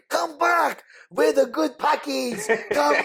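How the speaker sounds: high-pitched pleading call; calling after someone. Retold or imitated. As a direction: call her back, comically high. Retold calling after fleeing girl.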